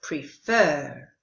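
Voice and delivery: prefer stressed on fer, pre kept short and light; teacher modelling prefer as pre-FER; stress on second syllable. Shown as a demonstration. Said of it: "with the stress on the second syllable".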